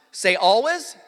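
loud, rising call for the crowd to repeat; call for repetition. Shown as a demonstration. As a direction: call it out so they echo you. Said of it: "Let me have you repeat something after me".

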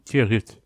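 "Dia Rit" said as Irish "Dia dhuit" in another dialect; Irish greeting. Shown as a demonstration. Teacher showing a dialect variant of hello, careful pronunciation.

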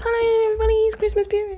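very high, squeaky hello; hammed-up high-pitched cheer; put-on high greeting. Guest greets everybody for the Christmas period, put-on high voice.

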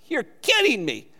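high, disbelieving mock squeal of a reluctant churchgoer; mock disbelief. Pastor mimics a churchgoer, shocked at leading devotional, mock squeal. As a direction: act stunned at being asked to lead devotions.

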